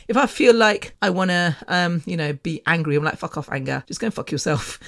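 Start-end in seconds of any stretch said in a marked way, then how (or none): 3.2-4.8 low, soft brush-off; muted dismissal. Retold or imitated. Speaker ordering her own anger away, muted dismissal. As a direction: send it packing under the voice.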